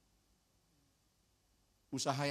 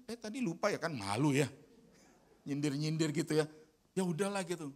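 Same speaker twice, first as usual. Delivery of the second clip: grumbling; 4 semitones higher, 8 dB louder than usual. Retold or imitated. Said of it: "Saya ngomel loh bener".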